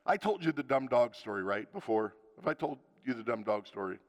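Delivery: normal talking voice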